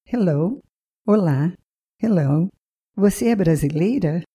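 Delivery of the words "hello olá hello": usual speaking voice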